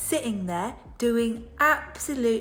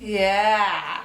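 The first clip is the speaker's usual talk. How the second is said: ordinary delivery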